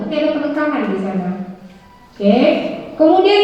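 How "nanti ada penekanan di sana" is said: normal talking voice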